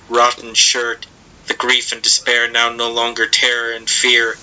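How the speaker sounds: plain reading voice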